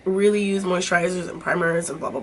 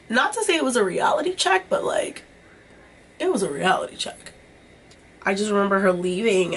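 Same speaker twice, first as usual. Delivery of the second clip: ordinary delivery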